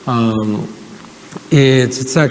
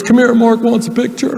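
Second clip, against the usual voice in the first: much higher, chummy call; Bill Clinton impression; 10 semitones higher than usual. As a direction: wave him over, Clinton style. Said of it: "I can do his voice really well".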